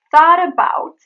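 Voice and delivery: "Thought about" joined with a tapped T; linked flap T. Shown as a demonstration. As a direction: link "Thought" into "about", loudly. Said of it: "It is thought about".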